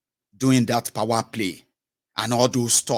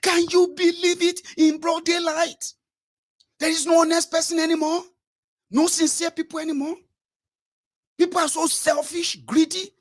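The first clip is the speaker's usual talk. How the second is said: much higher indignant merchant, louder; outraged businessman; 3 dB louder than usual. Retold or imitated. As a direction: complain high-pitched in disbelief. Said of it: "He collected the microphone".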